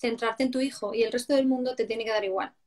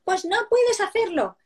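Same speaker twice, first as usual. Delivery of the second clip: put-on high, slow, bossy child copying a grown-up; child mimicking scolding; 8 semitones higher, 3 dB louder than usual. Child scolding another child, bossy mimicry of adults. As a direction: wag a finger like a little tattletale. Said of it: "se regañan y se hacen así".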